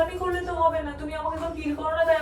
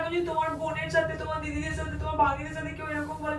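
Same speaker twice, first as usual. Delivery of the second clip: usual speaking voice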